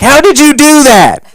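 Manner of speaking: very high, loud squeal of disbelief; astonished caller. Acted. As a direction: shriek it in mock amazement. Mimics astonished Klan callers.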